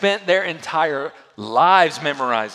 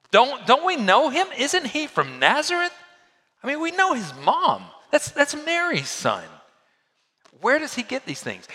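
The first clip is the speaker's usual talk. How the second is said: higher, incredulous voice of the hometown crowd; skeptical townsfolk; 10 semitones higher than usual. Retold or imitated. Preacher voicing doubting neighbors to the congregation, high incredulous tone. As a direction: scoff like neighbors who knew him as a boy.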